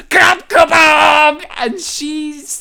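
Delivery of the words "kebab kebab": high, loud, crying yell of the name; mock terrified shout. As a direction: scream it in a hammed-up panic. Reenacting terrified movie scream.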